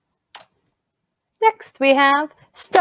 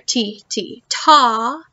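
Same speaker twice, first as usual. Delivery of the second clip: "ti, ti" short, "ta" held long; two short beats on "ti, ti", then stretch "ta"; short and long syllables; slower than usual. Shown as a demonstration. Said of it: "if they are short or if they are long".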